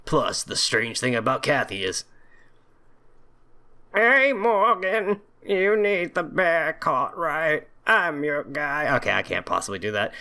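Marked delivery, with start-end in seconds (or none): 3.9-8.9 higher, slow exaggerated cocky hero; hero voice attempt. Player trying out a protagonist voice for Matthew, higher and slow. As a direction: play the cocky protagonist, drawn out. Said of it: "I gotta get a protagonist voice".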